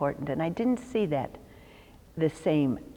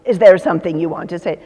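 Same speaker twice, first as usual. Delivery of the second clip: louder, emphatic replay of the bad opening question; louder mock quote of the question; mock interviewer; 11 dB louder, faster than usual. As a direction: hammer it out like the clumsy interviewer. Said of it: "you don't start a question".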